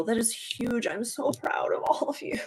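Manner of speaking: soft whisper, lips close to the mic; whispered praise. Real, not acted. Praising Nigel and the viewers, in a soft whisper. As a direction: breathe the pride out as a whisper.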